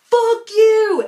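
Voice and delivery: shrieked high, loud insult; imitating a kid screaming at a trash can; mock yell. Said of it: "runs back to the trash can".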